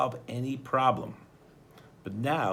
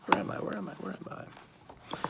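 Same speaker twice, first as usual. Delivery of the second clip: fading whisper; 8 semitones higher, 8 dB quieter than usual. Acted. Odd repeated line, fading to whisper.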